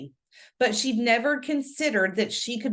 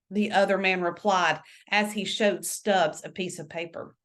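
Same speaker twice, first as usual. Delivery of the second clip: usual speaking voice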